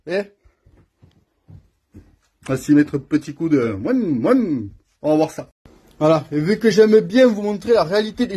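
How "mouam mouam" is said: two rising-falling vroom noises; imitated engine revs. Shown as a demonstration. Mechanic announcing a little rev, imitated engine revs. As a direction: mimic a throttle blip with your voice.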